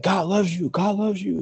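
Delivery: high, excited mock announcement; excited proclaiming. Woman acts out telling everybody the news, excited proclaiming.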